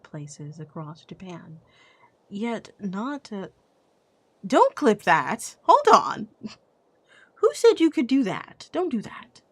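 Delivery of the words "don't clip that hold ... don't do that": mock outrage. Chat clips her mistake.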